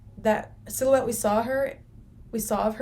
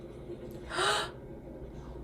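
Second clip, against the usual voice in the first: long, high, breathy gasp; sharp gasp; 10 semitones higher, 9 dB quieter than usual. Real, not acted. Viewer startled by the show, gasping. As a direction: gasp at what's on screen.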